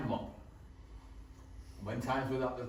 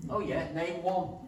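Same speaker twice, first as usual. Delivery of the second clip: cheeky retort; 6 semitones higher, 3 dB louder, slower than usual. Retold or imitated. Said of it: "And I'd say".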